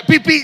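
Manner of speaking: loud horn beeps. Retold or imitated. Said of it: "le pita".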